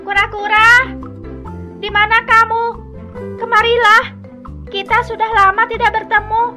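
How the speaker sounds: loud, high monkey shout; calling out. Acted. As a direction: holler for a missing friend. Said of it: "Si kera kemudian berteriak memanggil sahabatnya".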